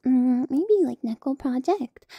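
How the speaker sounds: ordinary talk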